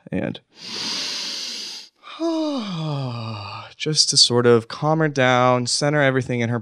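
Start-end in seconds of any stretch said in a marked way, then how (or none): 0.1-3.6 sighs. Retold or imitated. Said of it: "her often just going like".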